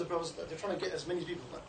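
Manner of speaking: usual speaking voice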